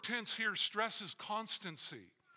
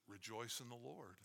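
soft repeat; 6 semitones lower, 11 dB quieter than usual. Shown as a demonstration. Softer repeat of the command.

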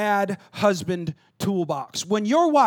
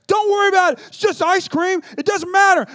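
yelled, pitched way up, louder; screaming dad; 10 semitones higher, 8 dB louder than usual. Retold or imitated. Dad yelling at his son over dropped ice cream. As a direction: bellow it down at the crying boy. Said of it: "the dad was screaming at him like".

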